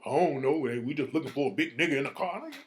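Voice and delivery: mocking, hurried, low-key mumbled excuse; mock silly talk. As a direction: imitate a clueless excuse-maker, tossed off. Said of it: "you talking silly talk".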